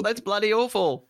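high-pitched mock Aussie protest; terrible Australian accent. Host protesting the score in a mock Australian accent. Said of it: "speak in terrible Australian accents".

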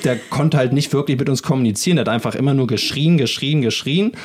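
ordinary talk, nothing marked

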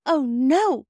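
put-on high, loud child's protest; dismayed refusal. A narrator's reading. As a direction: cry out in dismay. Phyllis objects to the game.

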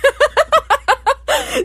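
high helpless cackle; laughing. Real, not acted. Host laughing at calling off the wedding, cackling. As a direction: burst out laughing.

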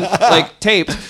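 ordinary talk

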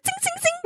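tiny high, soft zinging noises; squeaky little "zing" sounds; imitated tiny blade. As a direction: mimic a tiny knife swiping. Imitates tiny knife swipes.

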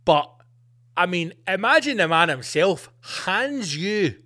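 ordinary delivery

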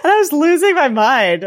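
high, loud, laughing delivery; cracking up. Real, not acted. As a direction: say it through real laughter. Cracking up at the retold scene.

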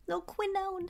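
small, high, soft pet voice; baby-dog talk. Pretend. Co-host naming her baby dog, high soft cooing. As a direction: coo it softly, high and tiny. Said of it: "Think of my little baby dog".

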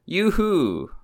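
high falling yoo-hoo; cowboy yoo-hoo call. Pretend.

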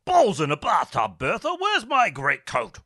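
pompous husband calling out; blustering gentleman. Acted. Rickett calls to his wife Bertha about his missing coat, blustering.

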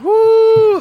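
high loud whoop; excited whoo. Real, not acted. Reacting to a fucking pseudo comedian insult.

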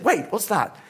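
high, frantic worried mother; alarmed mum. Retold or imitated. Mum on the phone hearing of civil war, high frantic alarm. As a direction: squeal it out like a panicked parent. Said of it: "Then she said".

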